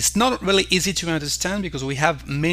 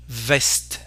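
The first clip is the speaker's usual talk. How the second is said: ordinary talk, nothing marked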